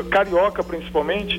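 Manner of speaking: normal talking voice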